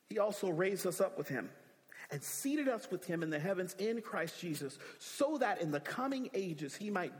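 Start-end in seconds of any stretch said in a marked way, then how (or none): none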